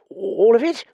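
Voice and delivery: dismayed question. Acted. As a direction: ask in high-voiced dismay. Reluctant Dallings questions the request.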